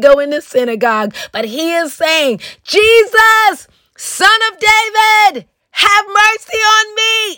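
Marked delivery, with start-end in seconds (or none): 2.6-7.4 high, stretched-out shouted plea. Acted. Blind beggar calling to Jesus from the roadside, shouting. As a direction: cry out high over a crowd for mercy. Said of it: "he said it with a shout".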